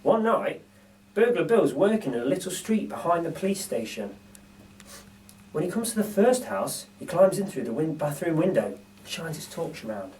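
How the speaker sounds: ordinary delivery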